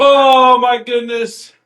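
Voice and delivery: high, loud exclamation; high-pitched delighted disbelief; amused shock. Real, not acted. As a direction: cry it out in astonishment. Listener reacts to story.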